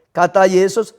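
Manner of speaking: ordinary talk, nothing marked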